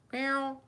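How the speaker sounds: high squeaky imitation meow to the cat. Calling back to the cat Katana, high squeaky meow. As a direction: mew up high like a kitten.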